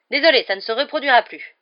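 loud apology. Acted. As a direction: call out sorry, it won't happen again.

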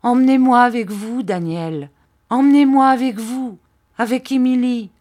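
narrated desperate entreaty; emotional plea. A narrator's reading. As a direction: beg to be taken along, voice lifting with feeling. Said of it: "cria mistress Gummidge du ton le plus ému".

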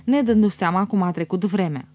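ordinary delivery, nothing marked

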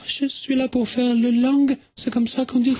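put-on a tourist girl explaining herself in French with a Swedish accent. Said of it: "mais quel joli accent!".

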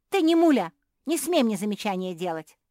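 very loud, indignant outburst; angry retort. A narrator's reading. Younger sister rejecting a scolding, angry. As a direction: start high and shout down the criticism.

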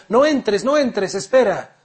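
loud, high, urgent plea; pleading cry. Acted. Mother begging her son not to enter, loud urgent plea. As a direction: beg him to stay out, loudly.

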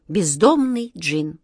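plain reading voice

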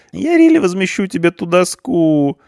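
very high, nasal-free whining youth; a scared punk pleading his case; whiny punk. A narrator's reading. Punk pleads with the policeman, high frantic whine.

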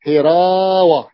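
"هراوة" slow, with a long stretched a; drawn-out word. Shown as a demonstration. Teacher showing the fi'ala pattern, drawn out. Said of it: "هراوة على وزن فعالة".

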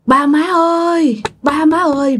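high plaintive calling; daughter calling out. Acted. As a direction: call to your parents through a shut door. Daughter calls parents from outside at night.

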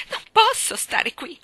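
high, loud, slow plea; imploring. A narrator's reading. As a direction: drag the words out in desperate appeal. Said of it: "in atto di implorazione". (implorante, acuta e lenta)

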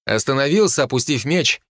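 ordinary delivery

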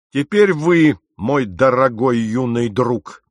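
emphatic accusing summons; menacing address. A narrator's reading. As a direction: bear down on the youth with false courtesy. Captain confronts accused youth with menace.

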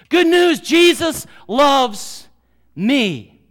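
high, loud shout, slowing right down on jesus loves; joyful shout. Retold or imitated. Camp boy announcing to the mocking boys, joyful shout. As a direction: call it out with pure joy. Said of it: "the guy says".

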